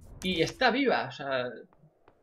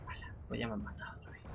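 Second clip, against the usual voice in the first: whispered grumbling, softer, fast; cursing under breath; 3 semitones lower, 15 dB quieter, faster than usual. Real, not acted. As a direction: mutter it to yourself. Muttering after dying again.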